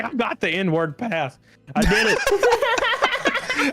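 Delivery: triumphant. Retold or imitated. Triumphant after the karaoke song.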